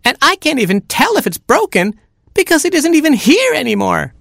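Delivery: very high little chick voice; screaming chick. A narrator's reading. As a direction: squeal the complaint as a tiny chick. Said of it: "screamed Baby Chick".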